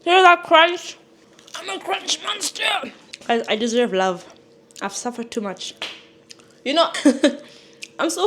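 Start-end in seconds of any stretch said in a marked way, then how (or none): 0.0-2.6 high, delighted about the crunch; excited. Real, not acted. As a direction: show off the crunch gleefully. Showing off the crunch.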